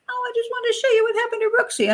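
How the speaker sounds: very high, quick girlish imitation; sweet, childlike tenant. Tenant showing the hurt cat to its owner, childlike mock voice. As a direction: imitate an innocent little-girl voice. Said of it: "and she goes".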